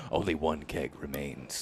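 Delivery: low, slow dramatic narrator; movie trailer voice. Pretend. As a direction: intone it like an epic trailer.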